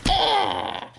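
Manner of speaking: high mock scream; falsetto cry. Lady Shrek caps her victory boast, high put-on scream. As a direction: let out a squeaky battle cry.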